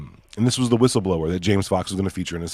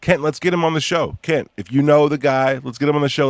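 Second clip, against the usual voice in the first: calling out; 7 semitones higher, 6 dB louder than usual. Real, not acted. Calling out to Kent on air.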